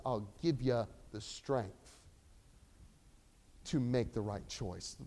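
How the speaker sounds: soft, slow, low reassurance; gentle promise. Acted. Preacher voicing the Holy Spirit gently promising strength.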